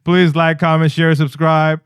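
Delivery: host signing off; announcer wrap-up; podcast outro. Acted.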